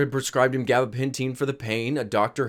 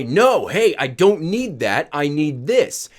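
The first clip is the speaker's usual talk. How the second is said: louder, insistent imitation of a cross dad; mock yelling; 5 dB louder, slower than usual. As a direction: snap back, mildly yelled, stretching the objection. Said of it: "he would yell at me and say".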